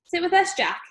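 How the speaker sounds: called out across a room, high; calling out. A narrator's reading.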